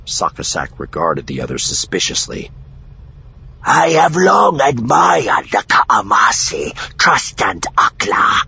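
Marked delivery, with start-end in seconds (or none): 3.6-8.4 loud, high, rough alien voice; slow, forceful alien with a challenging edge. A narrator's reading. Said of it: "his voice on the edge of challenging".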